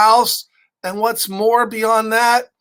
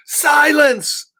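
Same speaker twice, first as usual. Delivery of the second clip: a loud, high royal yell; 7 semitones higher, 3 dB louder than usual. Acted. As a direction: shout the king's order down. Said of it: "sorry for yelling".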